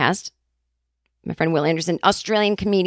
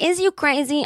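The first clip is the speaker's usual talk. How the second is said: higher, playful incredulous jab; sassy mock disbelief; 7 semitones higher than usual. Telling Sarah she's crazy, higher sassy jab. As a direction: scold a friend with sass. Quoting herself to friend Sarah.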